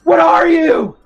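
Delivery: high, loud startled cry; startled shout. Acted. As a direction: shout it out in shock. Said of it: "looks at you and goes".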